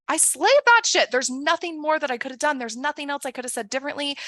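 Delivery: a coach acting out a client's high, loud victory cry; triumphant. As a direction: crow about nailing the interview. Triumphant after the interview.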